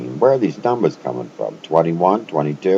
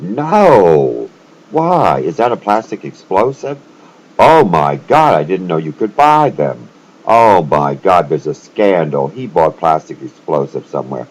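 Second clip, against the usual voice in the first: mock shock; 2 semitones higher, 12 dB louder than usual. Mock shocked reply to friend.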